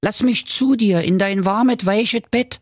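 high, wheedling frog voice; high-voiced frog angling for comfort. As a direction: plead to be let in, pitched well up. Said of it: "sprach da der Frosch".

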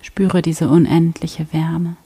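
ordinary talk

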